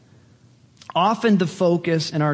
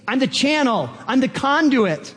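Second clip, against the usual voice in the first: higher, excited proclamation; elated declaration; 7 semitones higher than usual. Acted. Preacher as believer glad to be God's conduit, elated declaration. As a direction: proclaim it with delight, each phrase falling.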